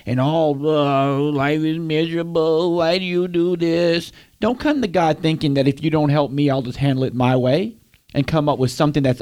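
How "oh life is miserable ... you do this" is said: slow, sulky self-pitying moan; mock moping.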